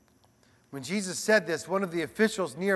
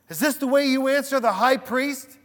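raised, louder indignant demand; a read-aloud challenge, pitched higher; 6 semitones higher, 6 dB louder than usual. A narrator's reading. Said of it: "he demanded".

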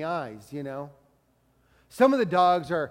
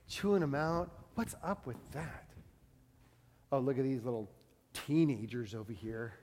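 lower, softer, sneering asides about strangers; catty judging; 6 semitones lower, 10 dB quieter than usual. Acted. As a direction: gossip under your breath at the mall. Judgy mall-goers voiced.

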